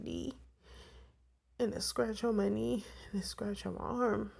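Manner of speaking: fighting back tears as she talks; tearing up. Real, not acted. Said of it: "I sound like I'm tearing up a bit".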